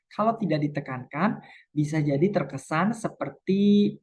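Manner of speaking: ordinary talk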